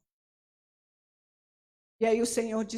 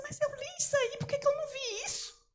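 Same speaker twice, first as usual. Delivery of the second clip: puzzled. Retold or imitated. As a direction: wonder aloud why you missed it. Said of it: "eu falava assim".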